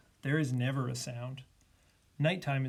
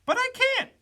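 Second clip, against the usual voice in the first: very high, loud little bird voice; high-pitched chirpy bird character; 7 dB louder than usual. A narrator's reading. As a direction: squeak it out as a tiny bird. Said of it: "said the B B bird".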